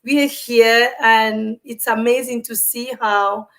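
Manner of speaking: normal talking voice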